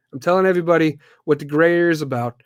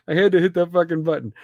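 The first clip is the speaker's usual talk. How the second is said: laughing; 3 dB quieter, slower than usual. Real, not acted. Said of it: "could hear the button".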